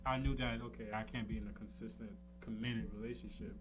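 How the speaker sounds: ordinary talk, nothing marked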